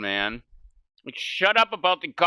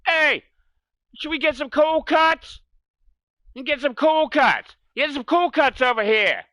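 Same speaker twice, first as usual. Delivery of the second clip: loud, high New Jersey guy hollering about cold cuts; New Jersey accent; 7 semitones higher, 4 dB louder than usual. Retold or imitated. New Jersey accent, mocking a guy ordering cold cuts. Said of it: "always somebody from New Jersey".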